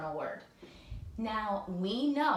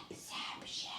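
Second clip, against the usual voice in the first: whispered "exception"; 10 dB quieter than usual. Shown as a demonstration. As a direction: breathe it out like a secret. Teacher whispers rule word.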